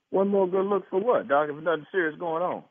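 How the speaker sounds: ordinary talk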